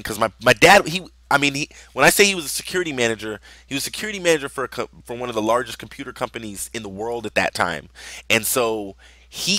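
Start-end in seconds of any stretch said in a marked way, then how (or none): none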